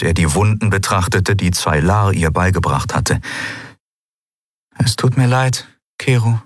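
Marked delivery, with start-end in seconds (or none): 4.8-6.4 put-on higher young woman's voice, gently sorry; lightly voiced heroine apologizing; Endriel, apologetic. A narrator's reading.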